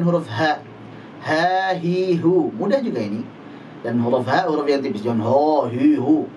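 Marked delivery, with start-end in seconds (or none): 5.2-6.3 ho hi hu with a heavy rounded dark h; wrong thick ha. Shown as a demonstration. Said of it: "jangan dibaca dengan tebal".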